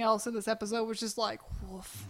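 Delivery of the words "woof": very soft, deflated "woof"; barely voiced verdict of disappointment; underwhelmed. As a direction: let it out under the breath, unimpressed. Sums up disappointing episode.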